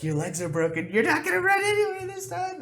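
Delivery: very high put-on taunt; taunting captor. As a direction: gloat over the downed player.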